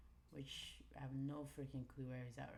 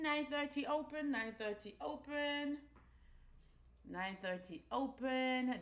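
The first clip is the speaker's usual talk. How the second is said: excited announcement; 10 semitones higher, 6 dB louder than usual. Real, not acted. Trader announcing the nine thirty open, high excited call.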